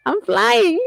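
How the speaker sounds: put-on high, playful kid voice; imitating her son. Son tells mom what he's doing, playful imitation. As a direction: chirp it proudly like a little boy at play.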